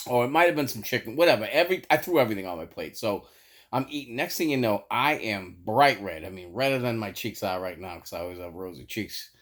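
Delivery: ordinary delivery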